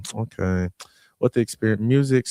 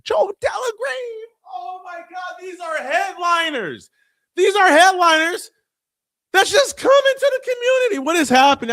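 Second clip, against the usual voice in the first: much higher, squealing hype; overwhelmed excitement; 5 dB louder than usual. Real, not acted. Host gushing over headline speakers, squealing excitement. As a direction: gush like you can't believe it.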